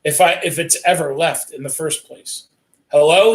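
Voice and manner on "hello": a second, louder hello into the phone; louder repeated hello. A narrator's reading. Sam repeating hello when nobody answers, louder. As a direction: call hello again, more insistent. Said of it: "he says again".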